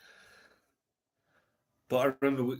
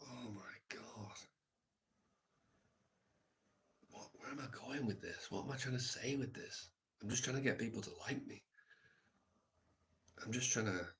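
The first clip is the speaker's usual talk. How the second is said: softer, self-doubting inner voice; inner thought; 6 dB quieter than usual. Retold or imitated. Judging his own poetry at the festival: quiet self-doubting thought. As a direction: think it aloud, quietly ashamed.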